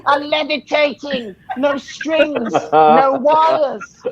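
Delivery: high, excited showman cries; over-the-top wonder at a fake trick; mock amazed. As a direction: hype up the fake levitation trick. Mock levitation showman reveal.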